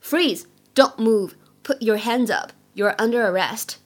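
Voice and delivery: firm police officer's orders; police command. Acted. Police officer orders a bad guy to stop, firm command. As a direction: punch out each order, then pause. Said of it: "警察叔叔对着坏蛋会说什么呢".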